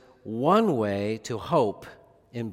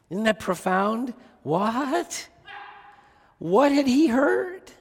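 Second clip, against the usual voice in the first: much higher, astonished questions; amazed wonder; 10 semitones higher, slower than usual. Real, not acted. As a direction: marvel aloud at his faith. Preacher marvels at thief's faith.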